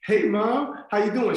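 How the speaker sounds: high, loud nervous greeting. Retold or imitated. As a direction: overeager hello from a nervous kid. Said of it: "I'm nervous".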